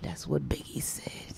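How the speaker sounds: soft unvoiced whisper. Real, not acted. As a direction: breathe it like a hushed aside. Aside honoring [name], whispered.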